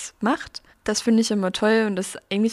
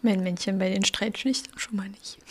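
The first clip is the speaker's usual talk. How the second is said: whispered sheepish confession; whispering; 5 dB quieter than usual. Real, not acted. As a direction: whisper it like a guilty secret. Confessing something embarrassing. (geflüstertes verlegenes Geständnis)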